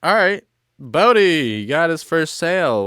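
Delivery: mock ceremony announcer. Coworkers cheering at the sale ceremony, loud and high. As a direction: announce it like a chant leader. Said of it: "chants and stuff like that".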